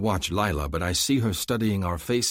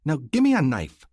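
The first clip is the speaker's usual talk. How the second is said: put-on bossy young woman; 8 semitones higher, 6 dB louder than usual. A narrator's reading. Demanding a weapon.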